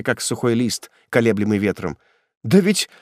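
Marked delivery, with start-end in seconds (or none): 2.4-2.9 high, strained disbelief; frightened stammering plea. A narrator's reading. Prisoner expecting release from executioner, frightened plea. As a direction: plead in rising alarm. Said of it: "пробормотал он коснеющим языком".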